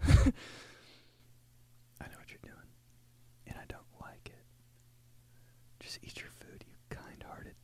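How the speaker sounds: hushed secret whisper. Retold or imitated. Thinking at the date while chewing, in a whisper. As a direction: whisper it like a private grudge.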